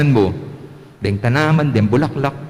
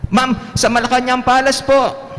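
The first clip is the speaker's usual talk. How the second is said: much higher little pupil voice; child's answer; 11 semitones higher than usual. Pretend. Pupil answering the teacher, much higher kid voice. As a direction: answer like a proud small kid.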